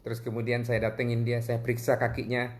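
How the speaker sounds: ordinary talk, nothing marked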